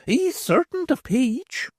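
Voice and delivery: put-on a knowing old beldam; old hag's voice.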